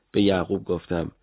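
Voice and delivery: ordinary delivery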